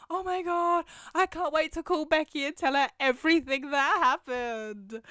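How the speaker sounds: put-on excited kid. Running home to call Becky, excited and gushing. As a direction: bubble over with news like a nine-year-old. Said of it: "being so excited".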